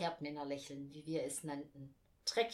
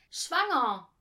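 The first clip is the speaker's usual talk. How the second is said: loud, high blurt; called out; 6 semitones higher, 11 dB louder than usual. A narrator's reading. Boy interrupts the Bible reading, calling out loudly. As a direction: shout the word out bluntly. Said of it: "rief Ralf Herdmann".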